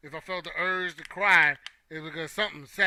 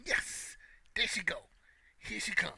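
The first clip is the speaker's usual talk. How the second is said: soft joyful hush; 3 semitones higher, 7 dB quieter than usual. Retold or imitated. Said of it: "Be happy".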